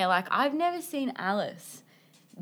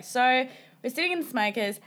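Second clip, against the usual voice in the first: ordinary delivery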